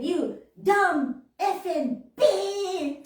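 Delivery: slow, loud, high mock cursing; retold swearing. Driver swearing at me, slow loud mock insult. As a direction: hammer out each word of a mimicked insult. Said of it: "said the dirtiest profanity to me".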